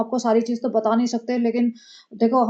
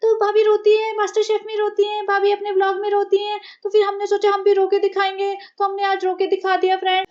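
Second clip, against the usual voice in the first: much higher, breathy put-on vlogger voice; mocking vlogger voice; 8 semitones higher than usual. Mocking the vlogger who cries on camera like her sister-in-law. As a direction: ham up a fake teary influencer. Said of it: "इसने इतनी नौटंकी की".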